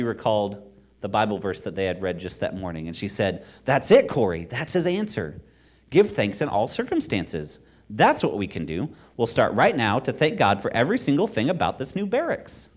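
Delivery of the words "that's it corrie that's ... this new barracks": eager, loud, lilting discovery; excited realization. A narrator's reading. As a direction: urge your sister on with sudden conviction. Sister excitedly finds the answer.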